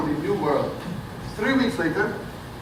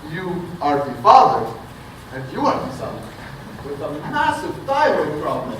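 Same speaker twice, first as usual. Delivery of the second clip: usual speaking voice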